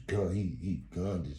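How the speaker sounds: ordinary delivery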